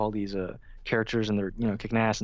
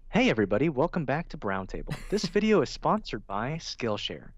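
YouTuber intro voice; 2 semitones higher than usual. Shown as a demonstration. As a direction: announce it like a slick video intro. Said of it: "like a news reporter voice".

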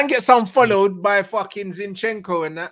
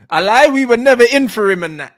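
louder mock denial of a rival fan; mocking Arsenal fans; 7 dB louder than usual. Mocking Arsenal fans denying the signing, louder mock voice. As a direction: play the sore Arsenal fan, brushing it off. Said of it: "That's what them Arsenal man are gonna be saying".

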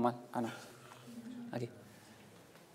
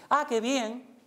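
higher, louder and bright with delight; pleased demo; 7 semitones higher, 5 dB louder, much slower than usual. Shown as a demonstration. Teacher showing emotional sync: higher, louder delight. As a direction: share their joy. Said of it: "es decir".

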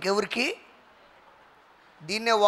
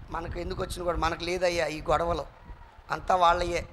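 mock indifferent; 6 semitones lower, 5 dB quieter than usual. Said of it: "భక్తిని ప్రదర్శిస్తారండి".

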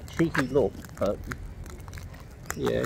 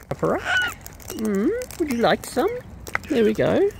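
high, bright coaxing call; 10 semitones higher than usual. Real, not acted. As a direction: coax the birds up high. Coaxing birds, high and loud.

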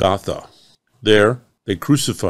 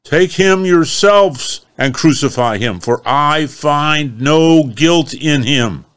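exasperated Pilate; 6 semitones higher, 5 dB louder, slower than usual. Acted.

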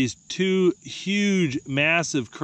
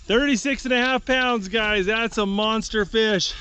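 excited; 8 semitones higher than usual. Real, not acted. Announcing weight to viewers, excited.

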